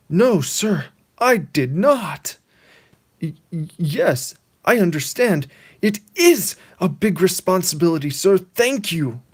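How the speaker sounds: high, loud, stammering shock; hammed-up flustered yes sir; stunned Pete. Pete on the phone with the governor, stammering shock. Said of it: "And he says".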